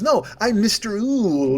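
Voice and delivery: slow, drawn-out Mister Ool in a mock accent; affected accent, stretching out Ool; haughty-sounding owl with a put-on accent. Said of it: "because he had an accent".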